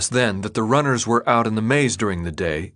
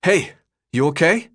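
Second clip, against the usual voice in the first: higher, concerned check-in; urgent concern; 7 semitones higher, 3 dB louder than usual. A narrator's reading. Thomas kneels beside the exhausted runner, concerned and urgent. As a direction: check anxiously that they're all right.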